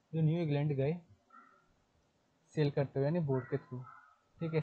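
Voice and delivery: ordinary delivery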